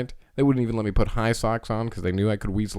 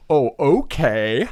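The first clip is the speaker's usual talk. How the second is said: very slow, drawn-out okay; mock slow agreement; 5 semitones higher, 4 dB louder, much slower than usual. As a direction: stretch it out innocently. Sister agreeing slowly.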